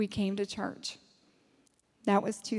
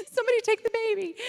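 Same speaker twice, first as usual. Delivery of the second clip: high, loud, rushed comic begging; mock desperate plea; 11 semitones higher, 5 dB louder, much faster than usual. Begging anyone to take the baby, mock desperate. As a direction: cry out in playful desperation.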